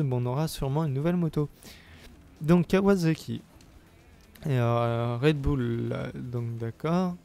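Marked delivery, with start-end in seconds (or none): none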